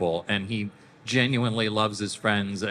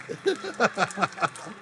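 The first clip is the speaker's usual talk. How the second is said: laughing; 10 semitones higher than usual. Real, not acted. Laughs after the joke.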